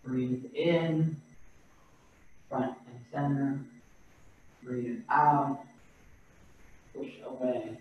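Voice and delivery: soft, unhurried breath counting, each cue left to hang; guided breathing cues. Acted. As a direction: guide the class through each breath, quietly. Leads slow breathing cues.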